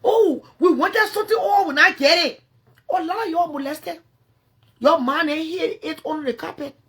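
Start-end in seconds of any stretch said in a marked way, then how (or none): 0.0-2.4 mocking quote. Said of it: "And you're so happy".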